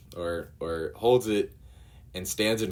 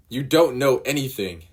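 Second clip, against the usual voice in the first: higher and louder, bitter retort; angry retort; 6 semitones higher, 7 dB louder than usual. Acted. Nami lashing out at Luffy, angry retort. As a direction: throw it at him in anger.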